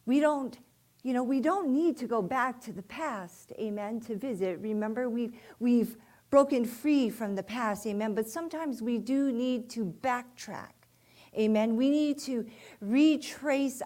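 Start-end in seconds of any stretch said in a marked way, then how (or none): none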